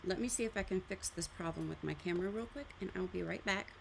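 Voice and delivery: usual speaking voice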